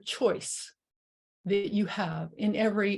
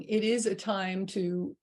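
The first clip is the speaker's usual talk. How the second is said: normal talking voice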